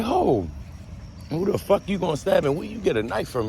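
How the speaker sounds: way up high and fast, mocking disbelief; incredulous high squeal. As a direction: squeak out the ridiculous question. Mocking the weak inmate's threat.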